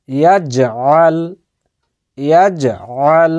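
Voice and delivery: ইয়াজআল spoken slowly, each sound clear; slow recitation. Shown as a demonstration. Teacher models the word for learners, slowly. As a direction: spell out ইয়াজআল at a slow pace. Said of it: "এটিকে উচ্চারণ করে আমি বলছি".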